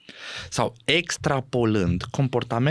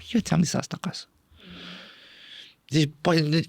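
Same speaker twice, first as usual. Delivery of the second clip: ordinary talk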